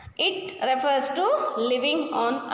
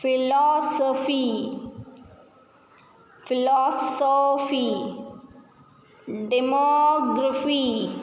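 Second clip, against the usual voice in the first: slow "philosophy" and "Demography", second syllable held; stressed vowel demo; slower than usual. Shown as a demonstration. Teacher drills students, "philosophy" and "Demography" slowly stressed on second syllable. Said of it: "Stress the vowel sound".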